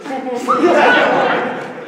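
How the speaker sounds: loud laugh. Acted. Laughter after sugar dumpling plea.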